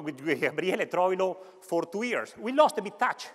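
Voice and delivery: ordinary talk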